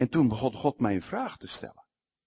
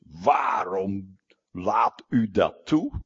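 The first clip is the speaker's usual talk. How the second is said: slow, drawn-out plea as Jonah; angry Jonah; 3 semitones lower, 5 dB louder, much slower than usual. Retold or imitated. Jonah complains to God, slow and sulky. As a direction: Jonah sulking at God, dragging the question out. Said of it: "Jona vreselijk boos".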